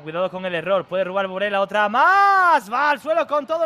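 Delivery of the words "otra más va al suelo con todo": excited. Real, not acted. Near miss.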